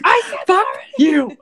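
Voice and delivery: high, loud exasperated snap; mock annoyed "I told you"; exasperated retort. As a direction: fire it back, fed up. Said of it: "You're like".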